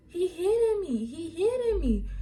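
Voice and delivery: high-pitched mocking imitation of her complaint; mock victim wail. Mimicking the girl's false complaint.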